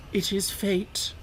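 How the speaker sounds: slow, fatalistic murmur; brooding fate. Acted. Toad talking to himself, slow and brooding. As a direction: let it sink in slowly.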